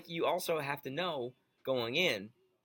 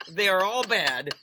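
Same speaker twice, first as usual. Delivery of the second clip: slow, louder, higher pronouncement; emphatic tweet reading; 5 semitones higher, 8 dB louder, slower than usual. Retold or imitated. Co-host reading out the president's tweet, loud and emphatic. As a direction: declare each word loudly.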